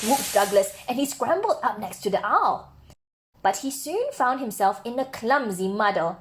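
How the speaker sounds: usual speaking voice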